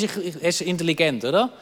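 usual speaking voice

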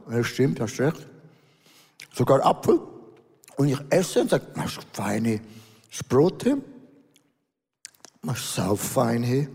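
talking while chewing; words round a mouthful of food; mouth full. Real, not acted. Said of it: "Mit vollem Munde sollte man es sprechen".

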